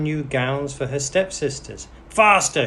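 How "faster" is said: loud sharp stepsister shout. A narrator's reading. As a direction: bark the order high and loud. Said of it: "shouted one stepsister".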